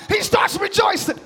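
high, shouted preaching; full-force preaching. Real, not acted. Preacher urging the church to rejoice before rescue, full-force preaching. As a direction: shout it out to fire up the congregation.

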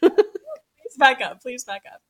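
high pleading, loud then dropping off; retold high-pitched plea, fading; exasperated plea. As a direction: act out begging someone for space. Retelling begging nurse to back away.

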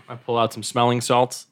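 ordinary talk, nothing marked

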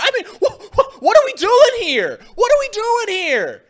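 high, loud incredulous put-on voice; high-pitched mocking outrage at a bad take; Elliott impression. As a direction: squeak out the disbelief like a mock Elliott. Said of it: "I'll do my best Elliott impersonation".

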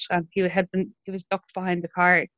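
ordinary talk

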